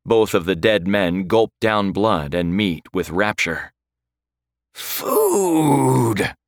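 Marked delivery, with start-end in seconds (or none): 4.7-6.4 a hoarse undead shriek of hunger; creature scream. A narrator's reading. The Draugr screams for food over the fawn. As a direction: scream it like a starving corpse. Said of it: "the creature screamed".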